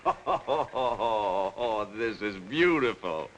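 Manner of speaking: slow words still laughing with delight; gloating laugh. Acted. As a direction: savor it through a chuckle. Calvert relishing the scandal.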